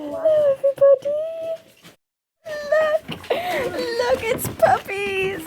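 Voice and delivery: squealing with delight at puppies; high, breaking with excitement; high puppy talk. Real, not acted.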